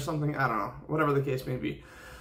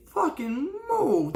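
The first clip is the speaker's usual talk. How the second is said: exasperated whine; 13 semitones higher, much slower than usual. Acted. Ranting at commuters.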